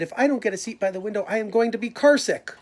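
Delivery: put-on high, loud, fast childish protest; whiny kid complaint. Alexander protesting in the carpool, high and whiny. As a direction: whine it like a fed-up kid.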